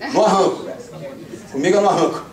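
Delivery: normal talking voice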